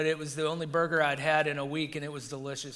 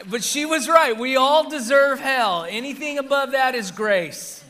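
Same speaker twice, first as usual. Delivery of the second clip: loud, high, driving proclamation; emphatic preaching; 8 semitones higher, 10 dB louder than usual. Real, not acted. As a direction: preach it out at full voice. Preacher drives home the punchline's truth.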